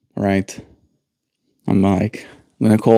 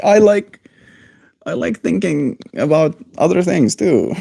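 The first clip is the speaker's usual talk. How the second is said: mock reply; 7 semitones higher than usual. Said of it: "I'm like".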